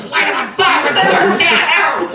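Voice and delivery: horrified outburst. Acted. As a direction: cry out in comic alarm at the screen. Acting out dad's horrified reaction.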